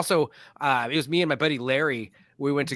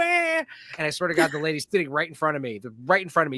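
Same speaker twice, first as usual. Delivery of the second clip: normal talking voice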